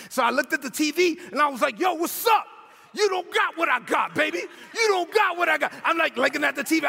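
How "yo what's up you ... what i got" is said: high, cocky Brooklyn taunt; retold yell, pitched up and boastful. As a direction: crow at a rival on the screen. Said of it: "I'm yelling at the T V".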